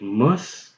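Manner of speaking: mus with the short u of wuss; pronounce mus. Shown as a demonstration. Teacher models mus for the viewer, short u. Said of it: "you change the first letter to an M".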